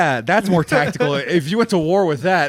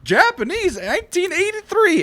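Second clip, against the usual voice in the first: hyped fan voice; 11 semitones higher than usual. Retold or imitated. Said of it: "Someone's gonna be like".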